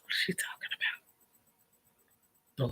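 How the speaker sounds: "What is she talking about?" fully whispered, no voice; breathy mock whisper to yourself; whispered aside. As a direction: whisper it like a secret joke to the camera.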